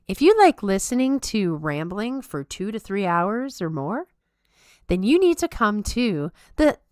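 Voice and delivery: put-on high, bubbly Valley Girl plug pitch; high Valley Girl promo read. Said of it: "Valley Girl voice forever".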